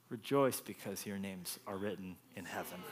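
Jesus gently correcting the disciples, very quiet; tender hush. Acted. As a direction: lean in and say it softly. Quiet tender climax of the verse.

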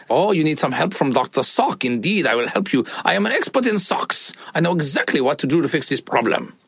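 hammed-up expert sock doctor. Dad playing Doctor Sock for his daughter, a grand mock-expert voice. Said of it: "His name is Doctor Sock".

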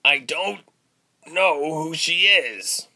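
high, loud, strained protest; straining captive, halting and drawn out; struggling to speak. A narrator's reading. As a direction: force the words out through pain. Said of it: "he struggled to say".

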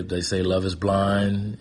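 normal talking voice

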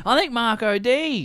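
high-pitched mock announcement; announcing. Imitating the boy bursting out with news, announcing. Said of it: "he's almost announcing it like".

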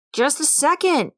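impatient call through a door. A narrator's reading. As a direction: hurry them off, annoyed. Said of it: "An impatient voice came".

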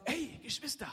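playful bragging call; cocky brother. Acted. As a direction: call out to your siblings, pleased with yourself. Acting Josef bragging to siblings.

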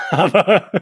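chuckling through the words; laughing. Real, not acted. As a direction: explain it while giggling. Lacht über eigene Pointe.

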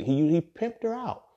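incredulous outburst. Real, not acted. Outraged at the Esther story.

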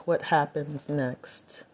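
hushed whisper; whispered aside to camera. Real, not acted. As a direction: breathe it out, almost voiceless.

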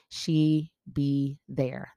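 slowly drawn, sassy; slow punchline. Acted. Bragging she kept her word, slow sassy emphasis. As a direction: lean on each word slowly. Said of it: "drawn out punchline".

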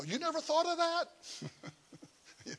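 high, slow mock disbelief, stretching the question; incredulous. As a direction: pastor teasing Paul who never thought of it, high and incredulous.

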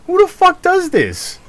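high mock-exasperated question; incredulous outburst. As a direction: squeak it out in disbelief. Exasperated quote of his own reaction.

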